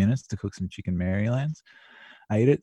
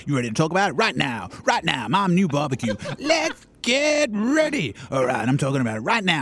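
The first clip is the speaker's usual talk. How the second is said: put-on American accent; 10 semitones higher than usual. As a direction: hype it up, American style. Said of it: "your Donald Trump impression".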